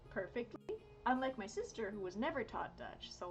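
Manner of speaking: normal talking voice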